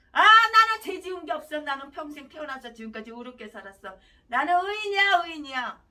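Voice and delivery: smug self-righteous sinner, loud at first; mock the boastful sinner loudly; mocking quote. Preacher mocks sinner's boast.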